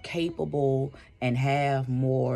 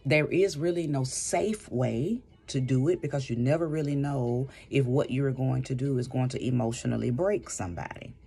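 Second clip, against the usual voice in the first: usual speaking voice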